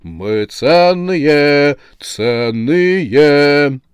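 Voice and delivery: very slow, loud chant; protesting chorus. A narrator's reading. Choir of heads disagrees with the saleswoman, chanting in protest. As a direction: stretch each word like a wailing choir. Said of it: "Хор голов был не согласен".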